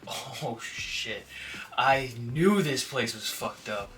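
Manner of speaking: voicing a nervous dog. Nervous dog voiced by owner.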